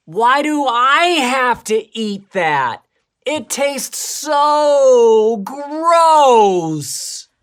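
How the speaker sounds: put-on high, drawn-out kid whine; whiny voice. Shown as a demonstration. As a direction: whine it, stretching every word. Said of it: "that was a good whiny voice".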